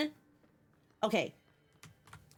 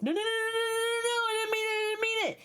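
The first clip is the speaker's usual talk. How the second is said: high singsong mock panic; half-sing the panicked no's; 10 semitones higher, faster than usual. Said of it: "And it's like".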